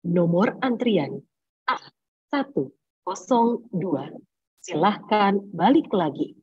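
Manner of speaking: slow, evenly spaced announcer voice; bank queue announcement. Acted. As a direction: call out a queue number slowly and formally. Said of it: "itu suka mendengarkan ada kalimat".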